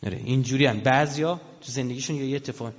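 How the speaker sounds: normal talking voice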